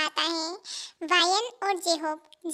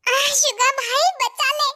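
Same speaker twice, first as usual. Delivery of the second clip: loud, high cry for rescue; hammed-up panicked call for help; panicked plea; 6 semitones higher, 6 dB louder, slower than usual. Chased friend begs his brother to save him, panicked.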